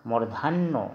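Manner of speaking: "मूर्धन्यो" said as "mordhanno"; Assamese letter name. Shown as a demonstration. Teacher naming the letter for students, Assamese "mordhanno" pronunciation. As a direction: double the n in "मूर्धन्यो", ending on o. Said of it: "असमिया में उच्चारण करेंगे".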